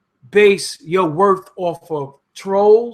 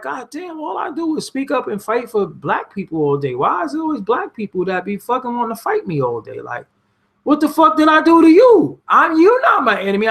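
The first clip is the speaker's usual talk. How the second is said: exasperated disbelief; 8 semitones higher than usual. Retold or imitated. Answering people who want to fight him, high and exasperated. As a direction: squeal in disbelief, then blow up. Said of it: "And I'm like".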